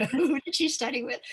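high, baffled questioning voice; half-laughing mock confusion; puzzled younger listener. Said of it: "Younger people will go".

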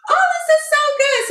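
high, loud burst of delight; thrilled. Retold or imitated. Retelling her study discovery, a high thrilled exclamation. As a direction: squeal it like you just found treasure. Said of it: "I'm like".

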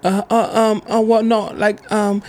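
hammed-up nervous fumbling for words; flustered stammer. Imitating the fake nurse stammering when asked real nurse questions. Girl caught lying, stammering.

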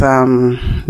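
usual speaking voice

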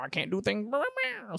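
high mock whine, words drifting to blah; whiny mock excuse. Storyteller mocking his friend's excuses: whiny high mock whine. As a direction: whine like a student dodging work. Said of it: "making a bald excuses".

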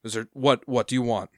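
irritated harbor master. Acted. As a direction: bark at the captain for lingering. Annoyed harbor master snaps.

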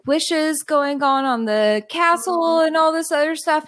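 usual speaking voice

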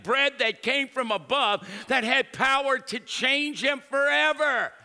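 high, loud, building sermon shout; full-force preaching. Acted. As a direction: preach it at the top of your voice, driving to a climax.

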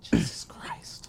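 breathy whispered exasperation. Real, not acted. Friend groaning about missing Comic Con tickets, whispered. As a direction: mutter it under your breath, unvoiced.

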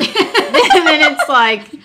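high, loud laugh spilling into words. Real, not acted. Guest laughing with the host about going to the gym. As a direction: laugh through the words as you start the quote.

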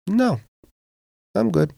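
imitating son. Father retells son's refusal.

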